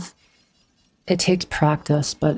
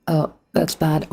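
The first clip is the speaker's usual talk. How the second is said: ordinary talk, nothing marked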